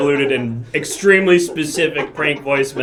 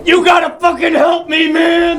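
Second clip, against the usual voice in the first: panicked shout; 11 semitones higher, 7 dB louder than usual. Acted. Panicked plea to doctor.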